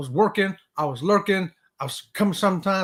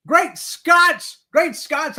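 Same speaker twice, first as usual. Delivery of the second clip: high, loud mock exclamation; playful exclamation; 9 semitones higher, 6 dB louder, much slower than usual. Real, not acted. Host greeting viewers named Scott, high and loud. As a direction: toss it out like a hearty cheer.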